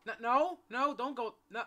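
high, loud panicked no's; panicked yell. Real, not acted. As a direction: yell in real alarm as they leave. Panicking as characters leave.

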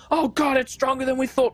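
a frightened fighter losing hope; panicked soldier. Acted. Soldier realizes the beast is too strong, high panicked voice.

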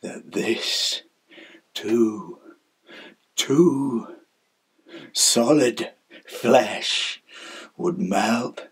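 low, soft, breath-heavy murmur; hushed despair. Acted. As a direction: begin almost in a whisper, despairing. Despairing opening of the soliloquy.

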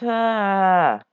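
ordinary delivery

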